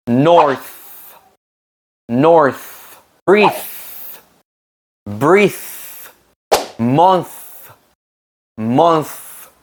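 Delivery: "Earth", "North", "Truth" with a long final TH; final TH drawn out on every word; exaggerated th practice. Shown as a demonstration. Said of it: "el sonido de la T H al final".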